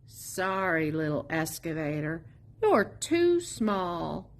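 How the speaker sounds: usual speaking voice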